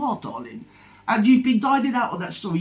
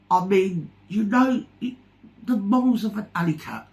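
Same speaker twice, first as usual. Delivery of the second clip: normal talking voice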